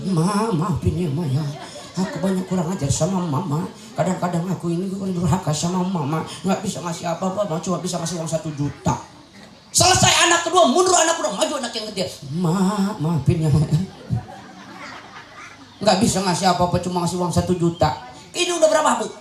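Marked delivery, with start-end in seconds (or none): 0.1-8.9 acted low, subdued pleading to a mother; pleading apology. Child apologising to mother over a small gift, low pleading. 9.7-12.1 high-pitched marshalling call; calling out orders. Acted. Organiser calling the second child forward, high calling-out. 12.4-17.9 acted low, subdued pleading to a mother; pleading apology. Child apologising to mother over a small gift, low pleading.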